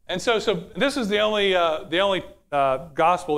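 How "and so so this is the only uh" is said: ordinary talk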